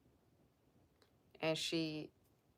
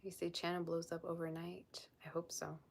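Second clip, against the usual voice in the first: unvoiced whisper, much softer; hushed whisper; 6 dB quieter than usual. Real, not acted. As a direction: read it out barely above a breath. Hushed whisper reading comment.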